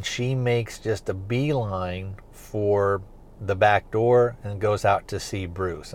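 ordinary delivery

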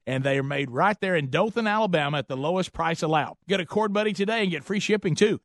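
upbeat sponsor read. Acted. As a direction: pitch it like a radio ad. Reads sponsor ad.

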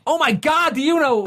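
high, eager gush; mock excited American. American hearing the name Sean, mock gushing excitement. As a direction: gush like a starstruck tourist.

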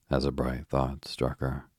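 ordinary delivery

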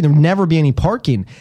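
usual speaking voice

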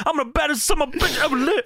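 Steiner boast. Retold or imitated. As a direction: brag like a crazed wrestler. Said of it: "He locks in".

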